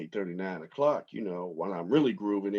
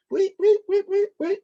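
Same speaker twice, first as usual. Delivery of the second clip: mock bird whistle; 6 dB louder than usual. Imitating bird's quick whistle reply.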